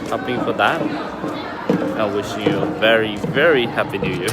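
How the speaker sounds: shouted greeting. Real, not acted. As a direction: call out good wishes over the celebrations. New Year greeting amid noisy celebrations.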